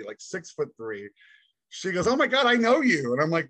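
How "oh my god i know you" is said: retold burst of delighted recognition; excited recognition. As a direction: imitate a deputy's surprised recognition, high and loud. Deputy recognizes him, retold.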